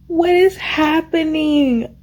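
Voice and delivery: stunned disbelief. Real, not acted. Reader reacts to a twist, slow high disbelief. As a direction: stretch it out in shock.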